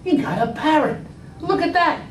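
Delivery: high excited wife. Acted. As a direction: gush like a delighted wife. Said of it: "wife comes in and says".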